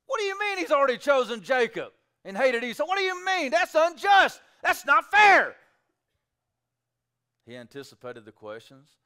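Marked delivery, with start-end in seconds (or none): 0.1-5.6 high, loud protest of unfairness; indignant objection. Retold or imitated. Preacher acting out the audience's outrage, loud. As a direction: cry out that it's not fair. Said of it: "His audience would have questioned justice too".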